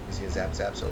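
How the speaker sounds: normal talking voice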